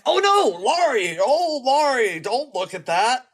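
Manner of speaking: high, loud mock dismay, drawn out; put-on horror. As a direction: act horrified for a friend, hammed-up and wailing. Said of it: "you learn to act the part".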